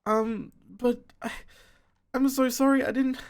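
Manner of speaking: high-pitched acted tearful apology; distraught mother.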